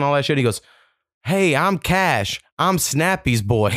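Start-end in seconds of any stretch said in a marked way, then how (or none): 1.2-3.7 put-on slow, higher-pitched little boy voice; little kid. Boy introducing himself to his dad's friend, little kid voice. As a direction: imitate a child earnestly introducing himself. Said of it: "I hear this little voice go".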